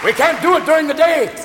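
high, loud vampire impression; Bela Lugosi. Advisor warns Ron against daylight, hammy vampire voice. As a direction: protest like a hammy movie vampire. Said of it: "looking a lot like Bela Lugosi".

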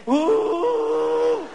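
high, loud ecstatic moan; mock female moan. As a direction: squeal it out in pleasure. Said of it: "make a woman say".

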